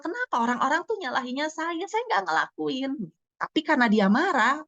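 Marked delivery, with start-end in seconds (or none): 0.0-3.1 put-on high, mildly protesting voice of a blamed kid; annoyed child. Blamed pupil protests he didn't do it, imitated high annoyance. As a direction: imitate a child's upset denial, pitched up. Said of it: "atau dia mungkin jengkel".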